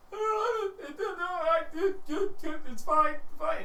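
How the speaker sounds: high, stammering protest; a hurt kid insisting he's fine. Acted. Injured kid on the floor stammering high that he's fine.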